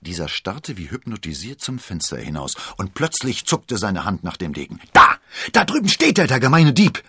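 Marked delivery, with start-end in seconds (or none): 4.9-7.0 high, very loud accusing yell; shouting. A narrator's reading. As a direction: shout out in sudden alarm at the thief. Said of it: "schrie er". (laut schreiend)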